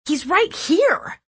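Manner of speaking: high, drawn-out insistence; frantic insistence. A narrator's reading. Narrator grabbing for Clancy's arm, high insistence. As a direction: insist with pitch shooting up.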